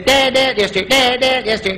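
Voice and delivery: imitated eager six-year-old; pestering little boy. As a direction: nag for attention like a small kid. Six-year-old son pestering his dad.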